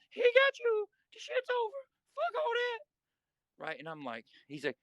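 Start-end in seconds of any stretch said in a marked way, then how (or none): 0.1-3.6 Whisper. Retold or imitated. Big inmate breaking up a fight, imitated high strained voice. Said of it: "He talked like this".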